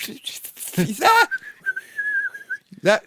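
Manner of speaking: high, loud screech of delight; excited shout. Pretend. Fan on his sofa spotting Ric Flair, shrieking with excitement. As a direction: yell like a fan who just spotted him.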